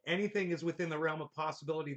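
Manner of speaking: quick, low-key read; reading a comment aloud. Real, not acted. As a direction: read it out quickly without investment. Reads a viewer comment aloud.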